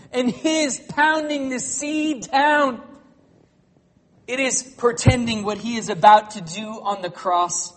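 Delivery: loud, high, ringing pulpit voice; thundering sermon delivery that climbs in pitch; full-force preaching. Acted. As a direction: preach it out over the whole room.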